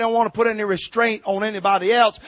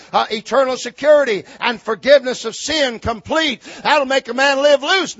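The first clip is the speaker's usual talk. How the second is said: raised, loud pulpit voice; higher, insistent sermon delivery; forceful preaching; 7 semitones higher, 9 dB louder than usual. Real, not acted. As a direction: preach the objection out hard.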